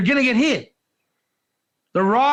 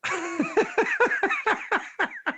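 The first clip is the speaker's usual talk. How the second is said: high laugh; 11 semitones higher, 5 dB quieter than usual. Real, not acted. Laughing at own story.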